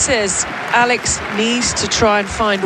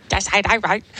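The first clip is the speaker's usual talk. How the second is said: mock Aussie. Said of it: "Your, your impersonations".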